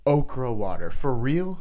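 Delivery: rising disbelief; incredulous. Acted. Mocking a health myth, incredulous "for real?". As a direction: ask it like you can't believe it.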